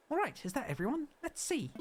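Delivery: bright, high and eager assistant; high cheerful helper voice. Pretend. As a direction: read it like a chirpy island assistant. Reads helper dialogue in voice.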